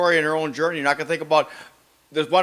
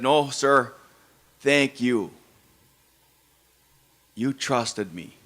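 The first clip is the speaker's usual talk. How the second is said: a touch of choked-up quiet; softly moved, lightly imitating tears; grateful driver thanking him, quietly moved; tearful thanks; 4 semitones lower, 3 dB quieter, much slower than usual. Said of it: "his eyes welled up, and he cried".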